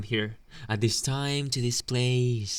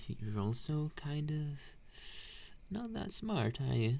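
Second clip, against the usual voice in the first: condescending tease; 4 semitones higher, 8 dB quieter, slower than usual. Acted. Bully insulting the nerd.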